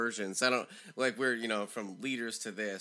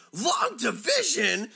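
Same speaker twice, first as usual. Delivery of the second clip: loud, high, drawn-out disbelief; incredulous yell; 12 semitones higher, 9 dB louder, slower than usual. Retold or imitated. Teacher stunned by pupil's answer, incredulous shout. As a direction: shout it back as the dumbfounded teacher. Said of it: "he couldn't even believe she said that".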